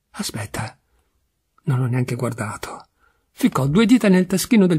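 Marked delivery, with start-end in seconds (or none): 0.1-2.8 whispered, low, to oneself; absent muttering. A narrator's reading. Memmo feeling for the card he hasn't looked at, absent muttering. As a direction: murmur it while trying to remember. Remembers the unread visiting card.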